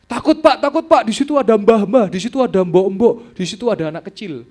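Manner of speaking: high, loud terrified pleading; frightened cry. Retold or imitated. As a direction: cry out in panic at what you see. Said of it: "Dia bilang gitu". (teriakan ketakutan yang keras dan tinggi)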